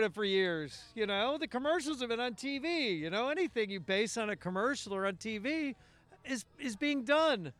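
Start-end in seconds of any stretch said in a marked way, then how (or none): none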